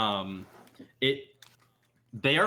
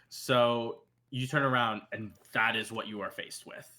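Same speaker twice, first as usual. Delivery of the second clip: ordinary talk, nothing marked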